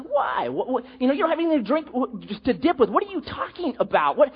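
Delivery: baffled disbelief. Retold or imitated. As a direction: play her as utterly bewildered by the offer. Samaritan woman baffled by Jesus.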